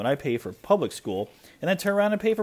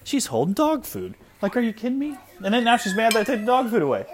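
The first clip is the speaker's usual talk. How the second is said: exasperated loud; 13 semitones higher, 6 dB louder than usual. Real, not acted. Dad reacting to toddler eating dog food, loud and incredulous. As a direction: ramp up, half laughing at the mess.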